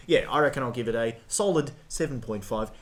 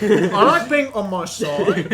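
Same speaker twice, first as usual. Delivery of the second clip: slow, high and louder, laughing; 8 semitones higher, 7 dB louder, much slower than usual. Real, not acted. Joking about his favourite sleeping position, laughing. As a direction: drag it out through a laugh.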